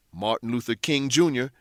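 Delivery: ordinary delivery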